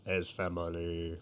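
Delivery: low, hushed, drawn-out villain imitation; mocking boss voice. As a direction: make fun of the boss with a slow, low, soft voice. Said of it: "making fun of the voice".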